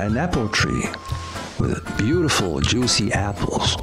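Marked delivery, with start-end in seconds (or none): none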